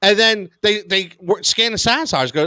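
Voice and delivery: normal talking voice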